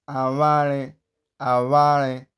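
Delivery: aware with the w squeezed through the lips; aware, a buzzing lip sound for the w; correct vw pronunciation. Shown as a demonstration. Teacher giving the example, correct vw pronunciation. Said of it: "You can hear how I'm pronouncing".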